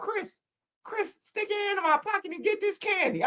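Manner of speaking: put-on very high, soft old-man falsetto; creepy old grandpa. Cartoon old man coaxing Chris, creepy high voice. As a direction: lure in a thin, high grandpa voice.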